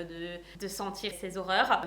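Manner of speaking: ordinary delivery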